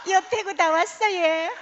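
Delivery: Gyeongsang-accented mock announcer; Gyeongsang dialect announcement. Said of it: "경상도 사투리로 하는 거죠".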